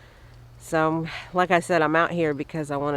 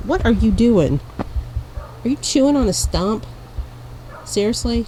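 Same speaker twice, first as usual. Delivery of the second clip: loud, higher startled scolding; surprised at the dog; 5 semitones higher, 6 dB louder than usual. Real, not acted. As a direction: catch the dog chewing a stump. Scolding dog chewing stump.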